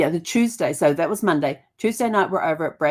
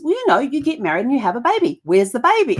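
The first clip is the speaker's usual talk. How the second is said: put-on higher, louder child's voice; a hint of a matter-of-fact niece; niece explaining; 7 semitones higher, 5 dB louder than usual. Niece voiced in retold story.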